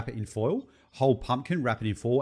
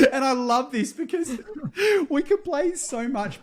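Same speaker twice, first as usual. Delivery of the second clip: laughing. Real, not acted. Still laughing from the meme.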